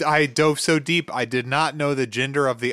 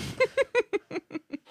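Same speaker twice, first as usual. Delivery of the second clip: high laugh; 4 dB quieter than usual. Real, not acted. Reacting to the joke.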